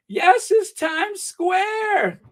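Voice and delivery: high, slow thrilled exclamation; excited. Real, not acted. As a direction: stretch it out with delight. Excited high drawn line.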